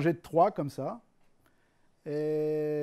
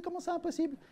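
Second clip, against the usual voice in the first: voiced friend; 13 semitones higher, faster than usual. Friend protests, high voice.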